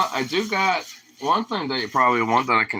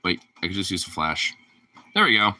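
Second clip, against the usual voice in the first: ordinary talk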